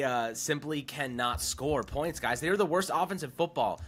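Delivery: ordinary talk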